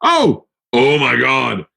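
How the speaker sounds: "Oh!" jumps way up high in surprise; sudden realization. Real, not acted. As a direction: catch yourself, remembering suddenly. Realizes the ad read is up.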